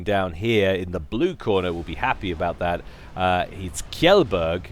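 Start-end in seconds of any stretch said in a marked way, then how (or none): 3.1-4.6 Kjellberg said slowly and carefully as KYELL-berg; careful foreign name. Shown as a demonstration. Caster trying out a Scandinavian player's name, slow and careful. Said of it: "tell me if I'm pronouncing that correctly".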